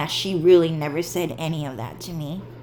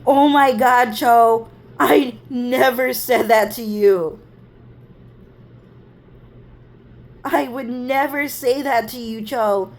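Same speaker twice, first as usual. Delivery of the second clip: loud, higher-pitched wounded protest; retold upset denial, pleading and raised; imitating tearful sister; 5 semitones higher, 5 dB louder than usual. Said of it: "then she would just start crying".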